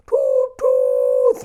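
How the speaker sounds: very high tooting; trumpet toots. A narrator's reading. Firefighters' band trumpet tooting, very high. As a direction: toot like a trumpet.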